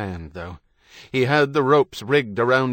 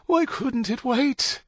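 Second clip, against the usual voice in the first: high, slow, drawn-out lament; distressed wail; 13 semitones higher, slower than usual. A narrator's reading. Wife in early labour lamenting the timing, wailing. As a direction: draw it out in high-pitched despair.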